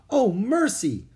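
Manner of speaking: high, loud exclamation; shocked cry. A narrator's reading. Woman sees a boy caught in the rope, crying out. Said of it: "a woman cried".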